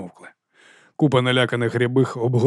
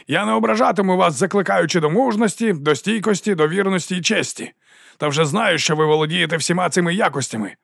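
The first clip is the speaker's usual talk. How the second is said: raised speech, easing down at the end; ringing oration; 6 semitones higher than usual. A narrator's reading. Captain mock-praises soldiers' virtues, ringing oratory. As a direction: declaim a list of virtues to the men. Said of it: "Його голос дзвенів".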